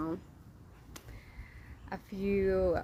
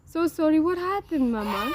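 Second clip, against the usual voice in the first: high-pitched, louder motherly sympathy; 8 semitones higher, 9 dB louder than usual. Real, not acted. Mother asks crying child what happened, soothing.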